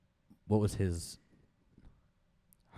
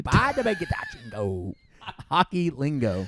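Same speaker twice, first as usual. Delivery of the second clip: mock hockey guy; 8 semitones higher, 4 dB louder than usual. Playful hockey-lingo mock voice.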